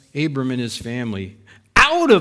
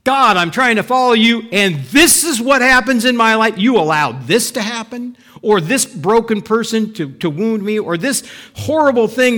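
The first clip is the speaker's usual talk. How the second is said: higher, louder pleading complaint; frustrated prayer; 5 semitones higher, 5 dB louder than usual. Retold or imitated. A believer complaining to God about suffering, higher and louder. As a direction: plead with God, hurt and indignant.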